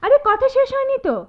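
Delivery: indignant call. Acted. Stopping him leaving.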